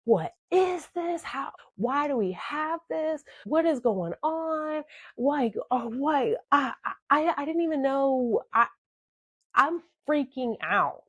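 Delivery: high-pitched, frantic mock yell without the volume. Girlfriend confronting her boyfriend about the drugs, freaking-out mock yell. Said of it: "I'm yelling".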